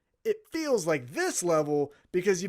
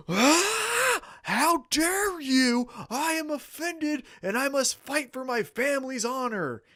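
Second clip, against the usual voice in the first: hammed-up offended nobleman, much higher and slow; theatrical indignation, pitched way up; mock outrage; 8 semitones higher than usual. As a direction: play a pompous figure insulted to the core. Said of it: "It wouldn't be".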